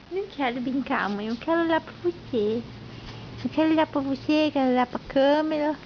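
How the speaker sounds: put-on quick, low, soft little child voice; baby voice. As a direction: speak for the baby, fast and hushed. Mother voices baby's answer.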